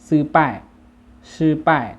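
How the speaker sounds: ซื่อป้าย as Chinese, high, loud and falling; Chinese pronunciation. Shown as a demonstration. Teacher models the word for failure, Chinese pronunciation of ซื่อป้าย. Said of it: "ภาษาจีนจะว่า".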